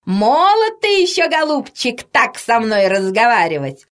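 high-pitched, offended granny voice; indignant shouting old woman. A narrator's reading. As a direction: scold him from above, starting high. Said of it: "закричала Анна Петровна". (возмущённая старушка кричит свысока)

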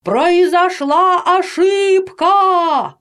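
high, very slow stretched-out holler; all-out shout. A narrator's reading. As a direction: cup your hands and holler each syllable. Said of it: "закричал изо всех сил". (протяжный крик изо всех сил)

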